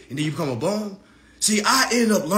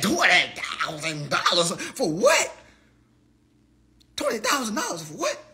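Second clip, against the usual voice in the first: put-on old head disbelief. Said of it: "the old head in me came out".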